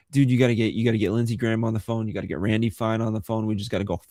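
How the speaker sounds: fast, pushy insider pitch; quick, breathless demands; rushed urging. Retold or imitated. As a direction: rattle it off like a frantic caller. Voicing the lobby's urgent plea.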